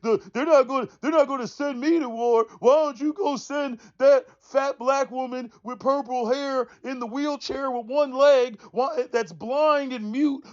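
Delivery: high, crying mock whine; whining, crying mock. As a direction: whimper like a spoiled complainer. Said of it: "Crying, whining, fussing".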